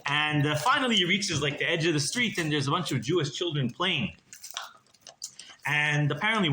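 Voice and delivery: ordinary delivery, nothing marked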